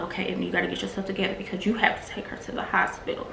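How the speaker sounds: soft, fast urging to herself; hurried hushed self-talk, steadying herself; hushed self pep talk. Retold or imitated. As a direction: rush a quiet pep talk under her breath. Talking herself down in panic.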